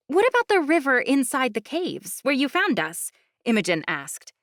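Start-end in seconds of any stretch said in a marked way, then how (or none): 0.1-3.1 high, eager questioning voice; curious young woman. Acted. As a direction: ask it high and keen. Imogen asks.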